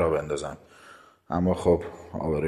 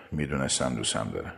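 ordinary delivery

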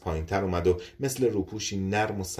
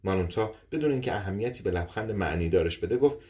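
ordinary delivery

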